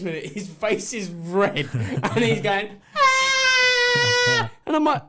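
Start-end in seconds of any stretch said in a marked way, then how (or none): 3.0-4.5 very high drawn-out mock scream; imitation of a screaming little boy; imitated child's scream. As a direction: shriek like a red-faced child. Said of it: "he ran screaming".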